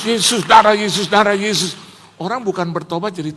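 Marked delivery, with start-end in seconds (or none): 0.1-1.8 loud, insistent mock cry; mock fervent shouting. Preacher mimicking zealots who only shout Jesus, in a loud mock cry. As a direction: shout it repeatedly like an overzealous believer.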